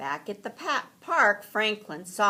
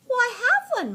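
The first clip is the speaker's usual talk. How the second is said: high, louder cheerful bunny voice; Rabbit's voice; 9 dB louder, faster than usual. A narrator's reading. Rabbit answers Franklin while tapping his knapsack, high and loud. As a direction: pipe up smugly as Rabbit. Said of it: "Rabbit replied".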